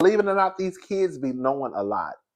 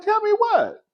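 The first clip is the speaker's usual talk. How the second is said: much higher eager question; slower than usual. Retold or imitated. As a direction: ask it high and curious. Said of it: "And I was like".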